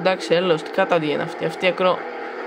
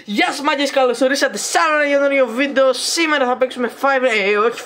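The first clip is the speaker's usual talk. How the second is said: high, loud hyped greeting; energetic channel intro, higher and louder; youtuber intro; 7 semitones higher, 4 dB louder than usual. Acted. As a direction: pump up the viewers.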